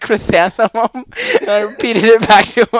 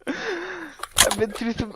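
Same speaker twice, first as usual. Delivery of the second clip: laugh-crying; 7 semitones higher, 12 dB quieter, much slower than usual. Real, not acted. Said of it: "second time you've cried on the podcast".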